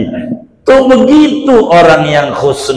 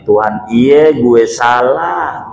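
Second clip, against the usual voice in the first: slow, blunt Betawi-style admission, lightly voiced; blunt Betawi confession; 3 semitones lower, slower than usual. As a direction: say it plainly in rough Betawi slang, slowly. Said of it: "bahasa Betawinya dengan kasar". (pengakuan blak-blakan gaya Betawi, pelan)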